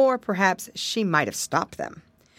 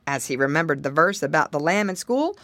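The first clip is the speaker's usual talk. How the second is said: plain reading voice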